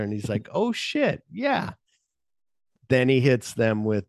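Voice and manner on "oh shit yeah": high-pitched mock realization; imitated impressed surprise, high; acted sudden recognition. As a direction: react as if it just dawned on you, voice jumping way up. Acting his sudden recognition.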